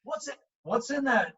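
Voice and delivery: high, eager prying questions; pry for the secret, excited; a coach begging to know the recipe; eager curiosity. Retold or imitated.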